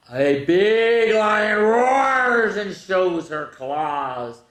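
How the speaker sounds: big loud slow roar of a line; roaring read. Acted. Teacher reading the lion page to kids, loud roaring.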